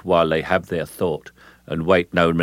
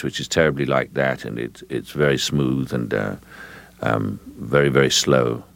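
smooth, low upper-middle-class British voice; upper-middle-class British pretension, all front; British upper-middle-class accent; 5 semitones lower than usual. Shown as a demonstration. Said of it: "British upper middle class accent".

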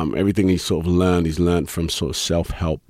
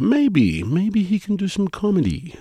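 high musing; 13 semitones higher than usual. Retold or imitated. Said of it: "made them think".

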